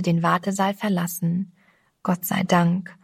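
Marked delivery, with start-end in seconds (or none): none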